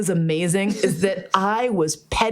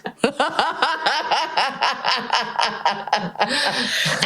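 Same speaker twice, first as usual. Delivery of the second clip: long laugh; 4 semitones higher than usual. Real, not acted. Laughing at her own story.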